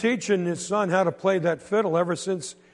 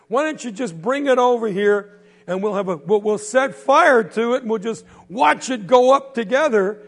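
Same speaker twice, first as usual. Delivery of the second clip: loud jeering; 4 semitones higher, 7 dB louder than usual. Acted. Bully hollering at the boy walking home, loud mockery. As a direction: holler the insult across the street.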